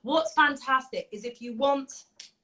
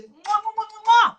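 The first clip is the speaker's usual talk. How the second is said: high, loud smacking air kisses; loud high mwah kisses; blown kisses; 10 semitones higher, 10 dB louder than usual. Real, not acted. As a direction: blow kisses to everyone. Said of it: "blowing kisses".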